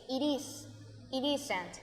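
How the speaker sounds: "It is, it isn't" with the t of "it" joined onto "is", "iɾ isent"; soft "It is, it isn't" linked as one word. Shown as a demonstration. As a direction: run "it" straight into "is". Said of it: "yo uno el it con el is".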